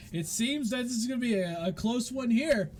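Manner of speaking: announcer. Acted. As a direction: play the hype man at the oche. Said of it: "and he goes".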